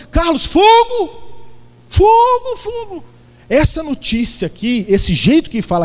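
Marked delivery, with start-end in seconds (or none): 0.1-3.0 much higher and louder, slow, alarmed; urgent shout. Retold or imitated. Friend phoning Carlos that his house is burning, frantic shouting. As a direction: yell the warning like the house is burning. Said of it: "O cara vai ligar e falar assim".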